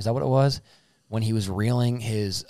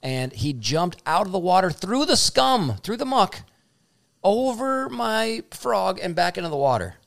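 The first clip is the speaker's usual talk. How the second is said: high, loud, thrilled; excited storytelling; 9 semitones higher, 4 dB louder than usual. Real, not acted. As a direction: build it up wide-eyed. Climax of excited fish story.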